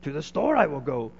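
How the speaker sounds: a hint of Yoda, slowed word order; a light touch of the Jedi master; a quick nod to Yoda, voice barely changed; lightly voiced Yoda. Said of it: "Yoda might say".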